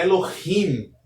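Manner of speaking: Elohim with a rasped h before the final syllable; "Elohim" with an audible throaty h; correct Hebrew pronunciation. Shown as a demonstration. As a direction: say "Elohim" slowly as elo-KHIM, stressed at the end. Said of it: "Observe que não é Elohim".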